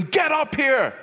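high-pitched angry yell imitating dad; father screaming. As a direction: yell it upstairs like a furious father. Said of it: "My dad starts screaming".

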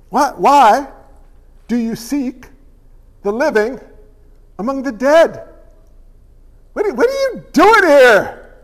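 lightly voiced angel, measured and pointed; a touch of a gracious angel's rebuke; gentle rebuke. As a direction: ask it like a kindly but firm messenger. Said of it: "they have a gracious, gentle rebuke".

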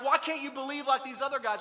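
loud, high-pitched scolding; mock an exasperated rebuke; berating. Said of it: "He doesn't berate him and be like".

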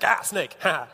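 ordinary talk, nothing marked

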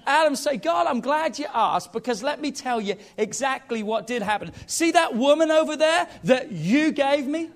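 loud, high preacher's voice; fiery sermon delivery; full-force preaching. Acted. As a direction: preach it out big to the congregation.

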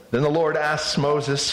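usual speaking voice

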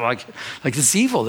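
usual speaking voice

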